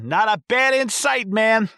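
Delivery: dopey exterminator. Retold or imitated. Exterminator reassuring the kids about bats, high and goofy. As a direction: toss it off like a clueless stoner.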